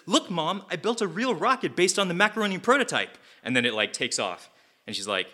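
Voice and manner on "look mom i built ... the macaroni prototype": put-on high, eager little boy; eager kid voice. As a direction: pitch up like a proud child showing his drawing. Young Gru shows real rocket.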